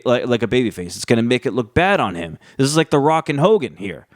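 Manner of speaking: usual speaking voice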